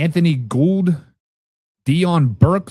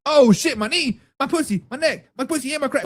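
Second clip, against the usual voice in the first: mock injured player; 9 semitones higher than usual. Acts out old player getting hurt.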